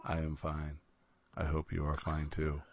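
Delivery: very low, subdued, placid voice; very calm. Pretend. Co-host reporting he is fine, very calm. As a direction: keep it deep and utterly calm. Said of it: "very calm today".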